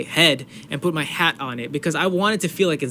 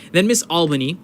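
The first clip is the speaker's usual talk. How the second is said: louder, raised call of a name; shouting out names; 4 semitones higher, 6 dB louder than usual. Real, not acted. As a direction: call the teacher's name out loud. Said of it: "just shouting their names out".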